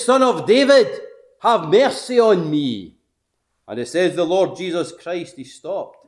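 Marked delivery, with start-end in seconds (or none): 0.0-3.0 loud, high plea to a passing master; crying out. Retold or imitated. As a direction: call out for mercy, raised voice. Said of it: "And he cried".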